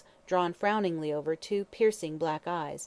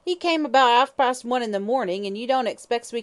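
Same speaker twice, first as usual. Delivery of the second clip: higher, louder coarse hag's voice; drunken old hag; 5 semitones higher, 7 dB louder than usual. Acted.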